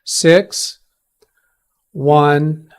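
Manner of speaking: slow, each digit spoken separately; over enunciating. Shown as a demonstration. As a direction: spell the digits out slowly. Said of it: "I'll say it slower".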